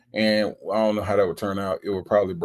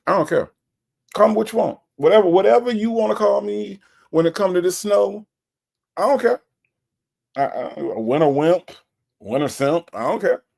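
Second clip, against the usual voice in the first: higher, louder defiant insistence; 9 semitones higher, 4 dB louder than usual. Real, not acted. Host answering a viewer who calls him a winter wimp, defiant. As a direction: own being a winter wimp proudly. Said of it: "Native is a winter wimp".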